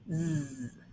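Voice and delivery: a long soft buzzing zzz sound. Shown as a demonstration. Teacher demonstrating the voiced S to pupils, soft buzz. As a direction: hum out a steady zzz. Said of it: "this S is voiced".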